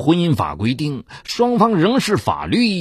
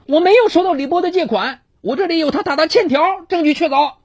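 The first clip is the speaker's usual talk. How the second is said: high, fast sharp rebuttal; retorting; faster than usual. A narrator's reading. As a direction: fire back in court. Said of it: "段春霞当庭反驳".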